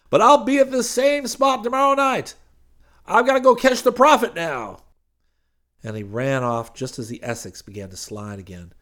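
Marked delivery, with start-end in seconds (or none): 0.1-4.8 quick, loud, high preacher voice; hurried preacher. Acted. Preacher hurrying off after the car, loud and quick. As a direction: rush the words out, loud.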